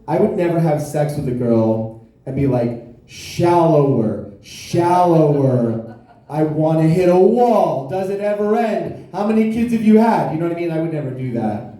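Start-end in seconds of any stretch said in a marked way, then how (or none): 3.1-10.3 hammed-up bedroom griping, building to indignation; mock exasperated complaint. Man mockingly demanding shallower during sex, mounting exasperation.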